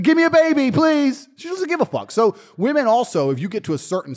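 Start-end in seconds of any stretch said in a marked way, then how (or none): 0.0-1.3 very high, loud mimicked plea; mock begging woman. Mimicking a chick meeting a star, mock begging. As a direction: beg eagerly in a hammed-up high voice. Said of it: "She's like".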